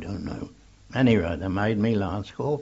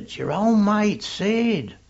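high calling voice; raised, high-pitched reply from afar; retold shout back; 9 semitones higher than usual. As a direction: imitate a mate hollering back. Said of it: "Somebody shouted back".

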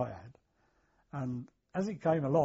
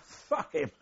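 put-on laughing, high Welsh accent; 9 semitones higher than usual. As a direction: mimic a Welsh soldier, laughing. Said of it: "in Welsh accent".

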